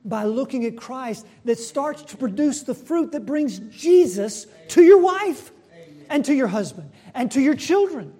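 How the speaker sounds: loud, high, forceful sermon delivery; impassioned preaching. Real, not acted. Preacher urging church away from law, impassioned.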